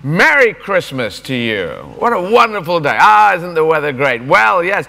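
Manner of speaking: loud, high, cheery shouted greeting; shouted pleasantries. Shown as a demonstration. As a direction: bellow niceties back at a heckler. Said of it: "just shout back".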